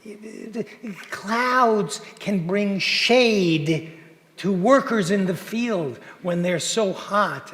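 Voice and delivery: drawn-out, deliberate, very slow teacherly voice; slow explaining man. Retold or imitated. Man patiently explaining weather to an American, very slow. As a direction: spell it out slowly like explaining to a child.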